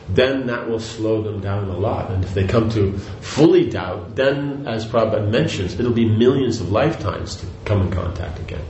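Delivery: ordinary delivery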